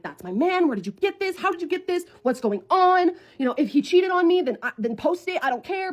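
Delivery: high volley of frantic questions; upset, freaking out. Retold or imitated. Confronting the stranger who has the boyfriend's video, upset. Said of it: "because I was upset".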